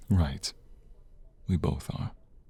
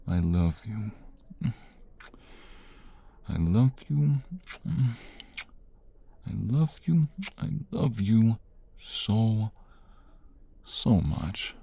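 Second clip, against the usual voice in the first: building declaration of love; 4 semitones higher than usual. Acted. Husband overwhelmed by twins news, building love declaration. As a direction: let it swell from a murmur to an overflowing cry.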